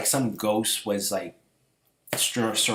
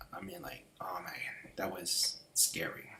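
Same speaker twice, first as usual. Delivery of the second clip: hushed whisper; 3 semitones lower, 13 dB quieter than usual. Real, not acted. Still shaken, recalling the coffin nightmare in a whisper. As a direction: breathe it out like it still scares you.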